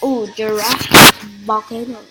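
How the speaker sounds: light up at the level name; excited ooh. Real, not acted. Gamer spotting the next course, excited ooh.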